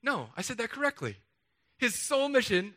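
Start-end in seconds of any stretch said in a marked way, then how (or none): 1.8-2.8 "His sole mission" pitched high and firm; emphatic restatement. Shown as a demonstration. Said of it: "I'm gonna say it with different emphasis".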